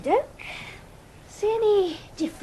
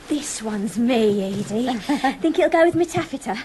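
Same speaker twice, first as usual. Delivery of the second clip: ordinary delivery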